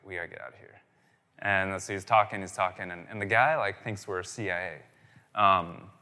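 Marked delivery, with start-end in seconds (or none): 0.1-0.8 hushed, low imitation of a friend's warning; very soft, low urgent aside; retold whisper. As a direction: drop right down, as if quietly warning a companion. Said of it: "my friend kinda whispers to me".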